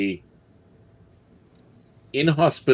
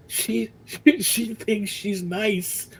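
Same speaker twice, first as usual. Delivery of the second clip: higher, slow, laughing guard jeering at the leader; laughing mockery; 13 semitones higher, slower than usual. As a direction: cackle it out between laughs. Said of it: "one of them as they're laughing".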